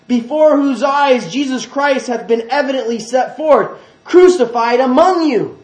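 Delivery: high, loud preaching reproach; scolding rebuke. Acted. Paul scolding the Galatians who were bewitched, forceful. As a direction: hammer out each phrase as a forceful rebuke. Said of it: "he calls them foolish here".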